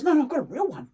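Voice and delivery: high, loud, gleeful correction; proud kid showing off; a delighted Peter correcting Des; excited. Retold or imitated. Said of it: "He goes".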